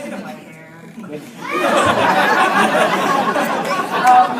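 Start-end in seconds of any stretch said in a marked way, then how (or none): 1.0-4.0 big laugh. Real, not acted. Bursts out laughing on stage.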